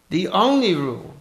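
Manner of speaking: put-on high, loud English accent. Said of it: "I sound like a fucking idiot".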